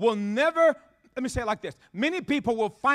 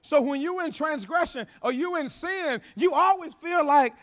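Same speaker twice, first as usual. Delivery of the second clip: normal talking voice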